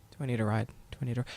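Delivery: low, quiet disbelief; seething, held back. Retold or imitated. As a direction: echo it low and soft, holding the anger in. Seething repeat of the taunt.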